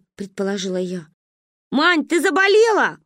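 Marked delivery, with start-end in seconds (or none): 1.7-3.0 высокий громкий вопрос; крикнуть наверх; крикнула. Acted. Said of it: "крикнула Каринка". (громкий оклик)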